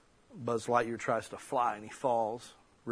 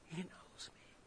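barely voiced whisper; awed whisper; 5 semitones higher, 21 dB quieter than usual. Real, not acted. Son moved that his ailing dad knows him, whispering.

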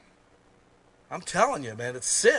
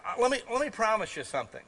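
ordinary delivery

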